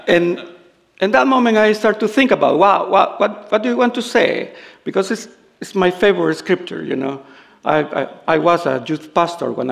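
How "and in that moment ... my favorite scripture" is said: louder and higher, building excitement; raised preaching voice. Real, not acted. Pastor raising his voice to the church, louder and higher. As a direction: lift the voice and preach it out. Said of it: "let me raise my voice".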